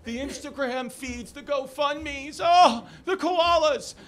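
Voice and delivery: high, slow, drawn-out mock lament; hammed-up grief. Comic mimicking somber koala news, hammed-up lament. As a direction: wail it slowly in exaggerated mourning. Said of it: "they made it so somber".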